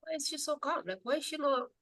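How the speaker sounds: high, very soft mock wondering; hushed puzzlement. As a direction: imitate baffled onlookers under your breath. Onlookers puzzled by her calm.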